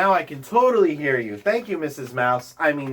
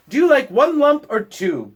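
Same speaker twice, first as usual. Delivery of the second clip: most polite; 8 semitones higher, 4 dB louder than usual. Acted. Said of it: "Bear said most politely".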